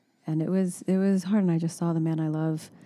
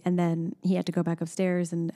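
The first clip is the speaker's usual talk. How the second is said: ordinary talk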